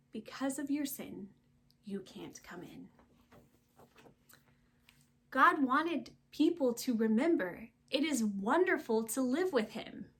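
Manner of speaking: ordinary delivery, nothing marked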